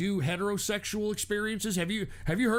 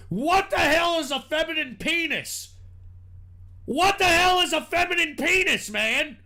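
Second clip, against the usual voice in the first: loud, higher, incredulous demand; 6 semitones higher, 4 dB louder than usual. Retold or imitated. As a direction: yell the question in disbelief. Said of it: "I asked the whole goddamn chat room".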